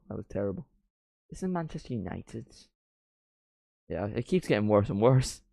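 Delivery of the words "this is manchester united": put-on softer, half-hearted Gary Neville accent; a quiet second try at the Mancunian accent; Gary Neville accent, retry.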